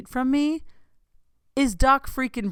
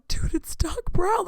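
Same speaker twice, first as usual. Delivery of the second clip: much higher, slower, strained with disbelief; hysterical fan; 11 semitones higher, slower than usual. Retold or imitated. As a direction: gasp it out to strangers walking by, beside yourself. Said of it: "I'm hysterical".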